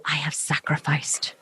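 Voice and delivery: hushed, martyr-like whisper. Retold or imitated. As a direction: breathe it like a sacred confession. Imitating a martyred vegan.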